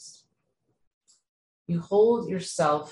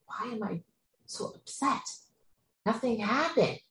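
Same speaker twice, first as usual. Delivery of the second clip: bewildered inner voice; 7 semitones higher, 4 dB quieter than usual. Retold or imitated. Voicing bewildered inner thoughts.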